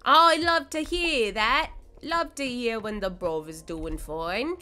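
playful mock British, loud at first; hammed-up British accent. Streamer glad the bruv is fine, hammed-up British accent. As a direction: open big in a fake British accent. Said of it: "that accent is so butch".

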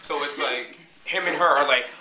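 ordinary delivery

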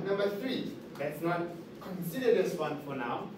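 normal talking voice

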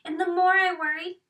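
usual speaking voice

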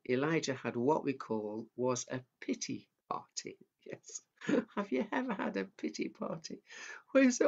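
ordinary talk, nothing marked

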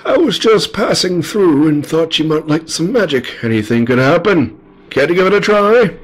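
high, lilting wizard making an offer. Acted. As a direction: tempt them with magic in a high old-storybook voice. Voices the wizard.

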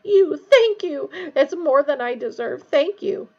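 high, loud, effusive gratitude; gasping Pettigrew. A narrator's reading. Pettigrew thanking Harry for his life, gasping. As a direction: grovel in a high, gushing voice. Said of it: "gasped Pettigrew".

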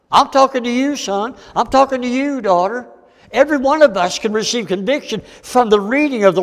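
high-pitched, insistent preacher; fervent preaching. Acted. Preacher voicing the Holy Ghost to Bible readers, fervent preaching.

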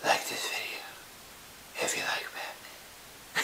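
low whispered vigilante voice; Batman. Pretend. Vlogger asking viewers for comments, whispered hero voice. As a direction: keep a hushed superhero rasp throughout. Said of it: "that's how you do a Batman voice".

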